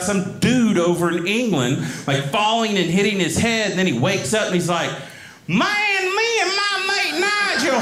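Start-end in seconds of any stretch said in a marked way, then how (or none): 5.5-7.8 put-on Southern accent. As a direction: complain in a hammed-up, high Southern accent. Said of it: "I sound like this".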